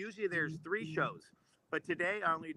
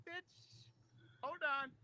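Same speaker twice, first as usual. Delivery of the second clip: high, softened mock comeback; mock retort; 10 semitones higher, 10 dB quieter, slower than usual. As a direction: answer back quietly in a raised pitch. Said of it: "We're like".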